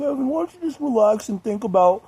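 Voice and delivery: high, put-on patronizing voice; condescending. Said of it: "all condescending".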